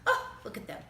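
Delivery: plain reading voice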